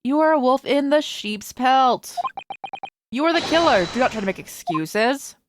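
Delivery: loud, forceful condemnation; indignant, declamatory charge of murder; stern accusation. Acted. Game character accuses the player of murder.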